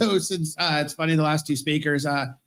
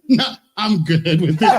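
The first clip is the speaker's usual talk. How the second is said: laughing through a retold answer; mock friend's answer, laughing; laughing reply; 6 dB louder than usual. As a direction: let the words bubble with laughter, louder.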